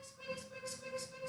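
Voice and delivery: very high, soft squeaks like a little mouse; tiny mouse squeaks. Shown as a demonstration. Teacher playing the pinky-toe mouse for little dancers, tiny high squeaks. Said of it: "mouse squeaks for pinky toe".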